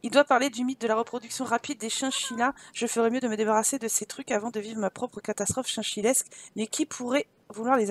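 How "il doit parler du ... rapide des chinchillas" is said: ordinary delivery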